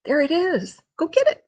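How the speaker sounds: high-pitched dog voice; playful pet talk. Real, not acted. Owner sending the dog after his toy, high and playful.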